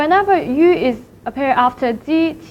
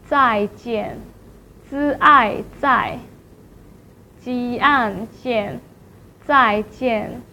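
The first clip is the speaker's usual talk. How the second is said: "zaijian" falling on each syllable, broken down slowly as "zi ai zai, ji an jian"; fourth tone; much slower than usual. Shown as a demonstration. Teacher drills see you slowly, falling "zaijian". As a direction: sound out "zaijian" part by part, each dropping. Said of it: "Here are some fourth tones".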